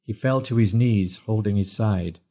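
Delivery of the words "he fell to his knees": ordinary delivery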